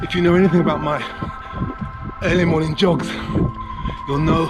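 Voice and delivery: breathless while jogging; talking between breaths on a run. Real, not acted. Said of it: "Out of breath already".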